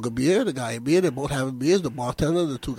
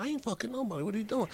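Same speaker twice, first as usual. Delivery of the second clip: much softer, slightly raised voice; quiet acted protest; 3 semitones higher, 17 dB quieter, faster than usual. Accused man answering his friend up the tree, quiet acted protest. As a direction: drop right down for the baffled denial.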